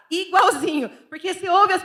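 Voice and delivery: playful Rio de Janeiro accent; carioca accent. Shown as a demonstration. Showing how fast the Rio accent stuck, carioca. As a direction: say it like a born carioca. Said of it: "peguei rapidinho o sotaque dos cariocas".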